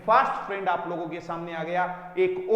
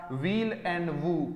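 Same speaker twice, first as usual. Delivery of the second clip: usual speaking voice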